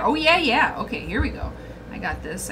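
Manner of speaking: normal talking voice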